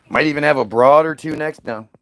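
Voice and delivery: ordinary delivery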